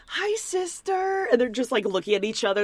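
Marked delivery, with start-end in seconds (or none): 0.1-1.3 mock sweet greeting. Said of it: "Danielle goes".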